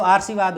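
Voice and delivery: आशीर्वाद said as "aar-shee-vaad"; mispronounced. Shown as a demonstration. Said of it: "नहीं, ये तो बात बनी नहीं". (आशीर्वाद को गलत "आर्शीवाद" बोलते हुए)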